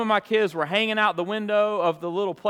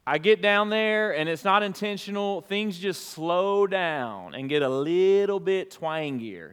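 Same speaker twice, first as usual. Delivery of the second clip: ordinary talk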